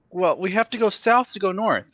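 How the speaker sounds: high, loud in-character justification; defensive excuse. Acted. Imp questions his route, player improvises excuse.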